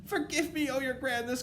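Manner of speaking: very high, loud pleading; grovelling underling witch; miserable cry. A narrator's reading. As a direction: beg forgiveness in a panicked squeal. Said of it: "cried the miserable culprit".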